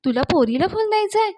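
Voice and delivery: high-pitched scandalised question; shocked suspicion. A narrator's reading. Girl suspecting boy of courting, high-pitched surprised question. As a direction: leap up in pitch with mock shock.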